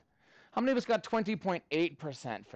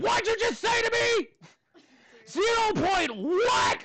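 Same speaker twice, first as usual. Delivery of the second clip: high, loud mock-outraged shout; scary teacher outburst; 5 dB louder, slower than usual. Scary teacher challenging a pupil's answer, exaggerated high shout. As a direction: shriek it like a hammed-up scary schoolteacher. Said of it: "your scary elementary teachers".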